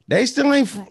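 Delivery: can't believe it, voice flying high; amazed. Real, not acted. Marvelling to the co-hosts that the ducks stayed, high and loud.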